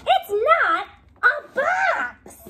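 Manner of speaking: high, loud, slow and exasperated; childlike protest, loud; insistent shout. Acted. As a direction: shout it out, fed up. Bunny insists it's not a box.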